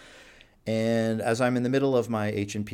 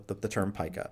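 normal talking voice

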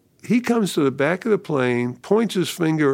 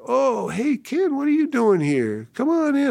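high, breezy fake welcome; mock friendly greeting; 12 semitones higher than usual. Luke greeting Ken at his door, high and falsely cheerful. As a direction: play the cheery host who doesn't see trouble coming. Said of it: "He comes to the door".

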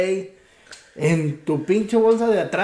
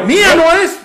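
higher, louder mocking replay of his friend's answer; mocking quote; 9 semitones higher, 8 dB louder than usual. Repeating his friend's answer to tease him, higher and louder. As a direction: push the mockery further, louder and higher. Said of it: "el güey dijo".

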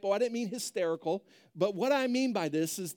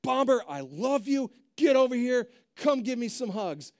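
loud, high, harsh commands to a dog; raised voice; 4 semitones higher, 5 dB louder than usual. Shown as a demonstration. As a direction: scold the dog with loving words. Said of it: "When we raise our voice".

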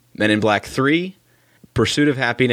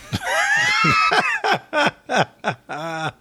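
high shrieking laugh. Real, not acted. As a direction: burst out laughing. Big laugh at fax joke.

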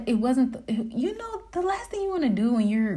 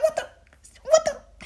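laughing disbelief. Retold or imitated. Woman learns the car is fine, laughing disbelief. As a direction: squeak it out through a laugh.

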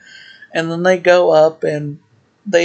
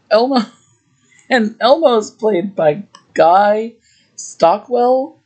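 usual speaking voice

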